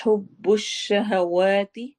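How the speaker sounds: हुब्बुश शहवाती slow, lips rounded, vowels long; slow Quranic recitation. Shown as a demonstration. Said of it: "होंठ गोल होंगे और लंबा होगा".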